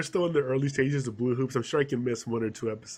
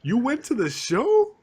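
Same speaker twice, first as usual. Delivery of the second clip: surprised; 10 semitones higher, 4 dB louder, slower than usual. Real, not acted. Amazed at friend's news.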